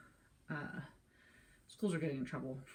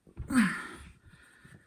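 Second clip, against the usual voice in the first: loud straining exhale; effort grunt; 5 semitones higher, 9 dB louder than usual. Real, not acted. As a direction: push the breath out with a grunt. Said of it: "Sorry, we're grunting the whole time".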